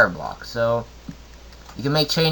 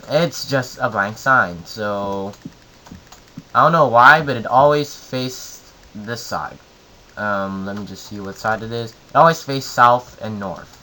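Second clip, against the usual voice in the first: ordinary talk, nothing marked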